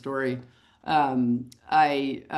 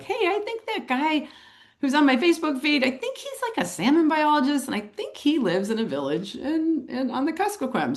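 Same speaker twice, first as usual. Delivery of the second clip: much higher-pitched thinking aloud; sudden recollection; 9 semitones higher than usual. Retold or imitated. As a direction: piece it together in a high, musing voice. Voicing her sudden recollection.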